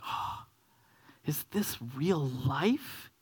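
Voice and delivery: hushed, slow gasp of wonder; awed disbelief. Acted. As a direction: act out a child barely believing the gift is real. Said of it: "you could hardly believe it was real".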